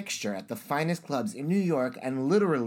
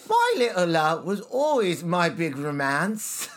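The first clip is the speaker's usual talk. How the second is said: high, broad Cockney; Cockney accent; 5 semitones higher, 4 dB louder than usual. Shown as a demonstration. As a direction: lay on a theatrical Cockney. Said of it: "Cockney act".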